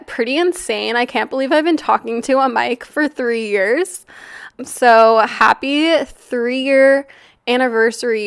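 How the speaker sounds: usual speaking voice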